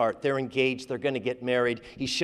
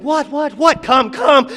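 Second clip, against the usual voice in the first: alarmed; 10 semitones higher, 8 dB louder than usual. Acted. Sweetheart alarmed, urging him.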